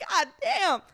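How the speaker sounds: a loud, high, hammed-up god damn; mock shocked exclaim. Friend reacting to the spitting, loud mock shock. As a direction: exclaim it like you can't believe what you saw. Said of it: "I'm like".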